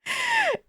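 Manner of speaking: high-pitched cracking up; laughter. Real, not acted. Storyteller cracks up at the curveball, laughing high. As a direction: burst into helpless high laughter.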